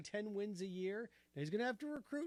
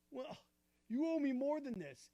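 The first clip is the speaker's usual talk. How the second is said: higher, louder hurt protest; the dumped partner pushing back, raised and higher; jilted protest; 7 semitones higher, 4 dB louder than usual. Acted. Acted breakup, jilted partner.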